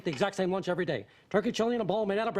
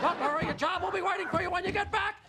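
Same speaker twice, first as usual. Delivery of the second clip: ordinary talk, nothing marked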